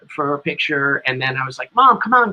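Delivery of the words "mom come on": louder, higher call to his mother across the room. Retold or imitated. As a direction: wave her in with a raised voice. Calling his shy mother over.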